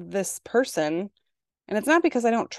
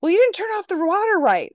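high, loud daughter complaint; whiny daughter; 13 semitones higher, 6 dB louder than usual. Retold or imitated. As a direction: complain like an upset kid. Daughter voiced, scolding mom.

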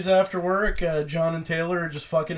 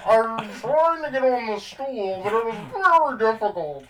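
hammy villain whine; Bane voice; 4 semitones higher than usual. Pretend. Continues Bane bit.

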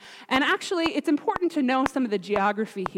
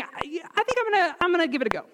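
tag; 7 semitones higher, much faster than usual. Retold or imitated. David tells the king he'll fight.

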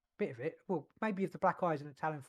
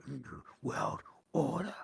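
sinister mock voice.

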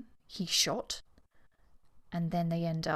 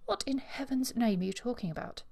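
a hint of an exasperated demand, higher; 6 semitones higher than usual. Sir Tristram demands an explanation from Eustace, mildly exasperated. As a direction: press her for an answer. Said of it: "demanded Sir Tristram".

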